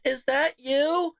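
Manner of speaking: lightly voiced poll worker asking; mock poll worker. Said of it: "She goes".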